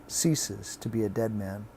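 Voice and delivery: plain reading voice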